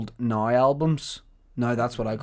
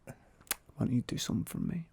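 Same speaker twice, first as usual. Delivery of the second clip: whispering; 13 dB quieter than usual. Retold or imitated. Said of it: "Yeah, he goes".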